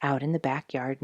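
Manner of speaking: ordinary delivery, nothing marked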